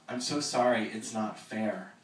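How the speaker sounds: loud start easing into a slow sorry; sorry, drawn out and pleading; pleading apology. Acted. Jack pleads with grieving Evan, slow and sorry.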